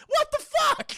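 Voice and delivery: very high, loud outraged yell; incredulous shout. Real, not acted. Streamer yells at the movie's plot hole, high disbelieving shout. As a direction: blurt it out in disbelief.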